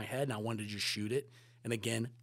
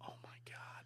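very soft whispered disbelief; stunned whisper; 18 dB quieter than usual. Retold or imitated. Seeing the Spielberg bobblehead in the bathroom, stunned whisper. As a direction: barely voice it, stunned.

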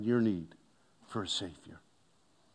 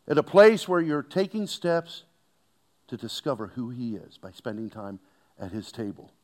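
usual speaking voice

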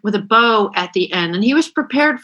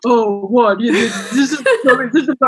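mock the husband with a lightly lowered, slow-witted tone; much slower than usual. Wife imitating her husband to the guest, light husband voice. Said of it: "they all use the husband voice".

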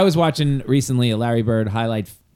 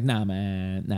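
softer, lower laid-back imitation; imitating Pearl Washington; 4 semitones lower, 6 dB quieter, much slower than usual. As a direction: lightly voice a laid-back refusal. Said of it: "That's how he sounded".

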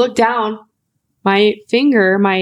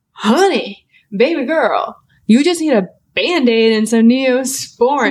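slow, higher, sing-song pet-name coddling; retold condescension; 3 semitones higher, slower than usual. Doctor telling patient it only needs a Band-Aid, patronizing. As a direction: imitate a doctor humouring a patient, drawing words out.

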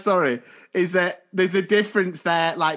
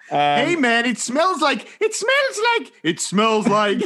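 mocking mishmash of American, Scottish and Irish accents; muddled American-Scottish-Irish accent; 3 semitones higher than usual. Teasing co-host's bad attempt, muddled American-Scottish-Irish accent. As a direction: wander between American, Scottish and Irish, pitch jumping about. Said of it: "it was like an American, Scottish, Irish accent".

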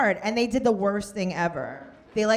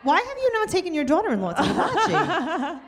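higher, laughing mock indignation; playful mock outrage; 9 semitones higher than usual. Real, not acted. Host teasing in-laws about hibachi, mock outraged. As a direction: scold them in jest.

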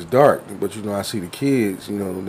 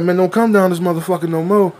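mock tough kid; 7 semitones higher than usual.

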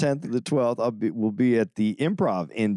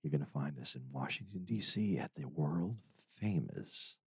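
radio voice; 6 semitones lower, 13 dB quieter than usual. Pretend. Host plugging the Washington show, jazz radio voice. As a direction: sink into a late-night DJ murmur. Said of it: "in your radio voice".

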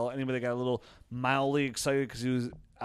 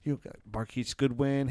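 normal talking voice

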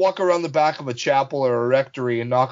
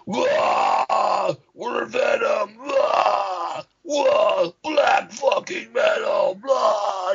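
hammed-up high metal-singer roar; 10 semitones higher, much slower than usual. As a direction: imitate a silly stage shout, drawn out. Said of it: "[name], their singer, he'd, he'd come out".

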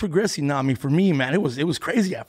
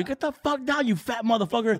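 heckler yelling; 8 semitones higher than usual. Retold or imitated.

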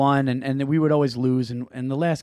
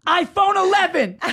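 high, excited shout through laughter; laughing, triumphant cry of iPhone eleven; daughter's victory shout; 8 dB louder than usual. Retold or imitated. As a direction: yell it like a kid who just scored. Daughter celebrating goal.

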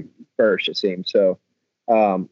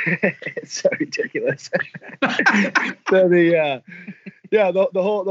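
laughing; 10 semitones higher than usual. Real, not acted. Laughing mid-answer.

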